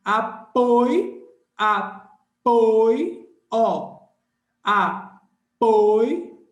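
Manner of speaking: Apoio repeated loudly with a rise; teacher shouts apoio to show the diphthong; loud drill. Shown as a demonstration. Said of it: "um movimento só também".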